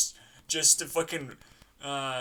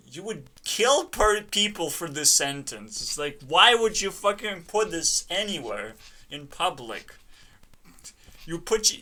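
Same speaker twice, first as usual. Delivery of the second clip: ordinary talk, nothing marked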